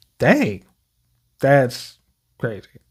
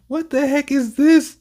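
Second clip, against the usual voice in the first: surprised what; 10 semitones higher than usual. Real, not acted. Reacts to sudden footage.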